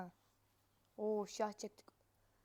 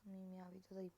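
ordinary delivery

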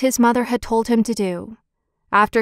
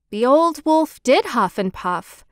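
usual speaking voice

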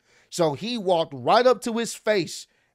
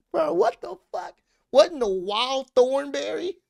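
high-pitched, incredulous bro outburst; stunned disbelief; 9 semitones higher, 3 dB louder than usual. Retold or imitated. Reacting to the wrestling show, high and stunned disbelief. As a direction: voice jumps way up in shock. His own shocked reaction, retold.

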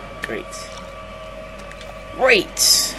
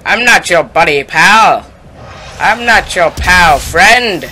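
louder, squabbling Canadian cartoon accent; a loud South Park Canadian bicker; 3 semitones higher, 8 dB louder, slower than usual. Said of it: "I'm a Canadian from South Park".

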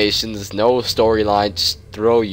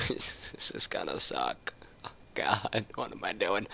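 breathy laugh, then a whispered dread; half-laugh it under your breath, scared; nervous laughing whisper; 3 semitones lower, 14 dB quieter than usual. Real, not acted. Scared gamer whispers in dark.

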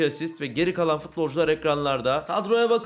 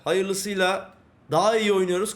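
usual speaking voice

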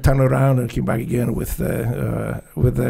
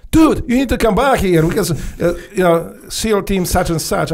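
higher, louder urgent order; urgent master chief; 5 semitones higher, 4 dB louder than usual. Retold or imitated. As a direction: call him home urgently, a bit raised. Master chief ordering him back.

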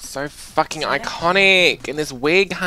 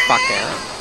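high angry shout. Real, not acted. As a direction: yell it at her. Telling off the mum.

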